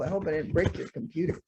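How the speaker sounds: ordinary delivery, nothing marked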